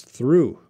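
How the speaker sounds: THROUGH modeled carefully, falling at the end; "through" said distinctly on its own; clear pronunciation demo. Shown as a demonstration. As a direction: model the word "through" for a learner. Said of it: "through is too hard".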